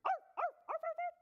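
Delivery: loud, high puppy yaps; dog bark. Acted. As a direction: bark sharply like an excited puppy. Puppy shows off barking.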